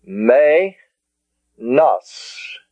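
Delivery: "Me, nos" with "Me" rhyming with day; syllable by syllable. Shown as a demonstration. Teacher showing the diphthong in "Me, nos", syllable by syllable. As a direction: stretch "Me" as MAY, pause, then "nos". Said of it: "It's pronounced as an A".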